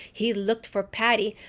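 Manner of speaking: ordinary delivery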